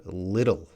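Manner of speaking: little with TT voiced like D; D-sounding TT. Shown as a demonstration. Teacher demonstrating the T T sound, American D-like flap. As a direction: say "little" as "liddle". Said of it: "might sound like a D sound".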